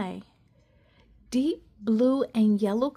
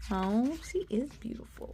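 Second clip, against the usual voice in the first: hushed, admiring, much softer; soft admiring aside; 10 dB quieter than usual. Acted. Narrator to listeners about Cassie, soft awe. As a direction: breathe it out in quiet awe.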